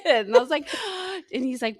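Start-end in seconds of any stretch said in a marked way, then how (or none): 0.7-1.3 retold gasp. Mom retelling shocked reaction.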